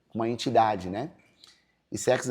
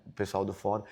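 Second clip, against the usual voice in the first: ordinary talk, nothing marked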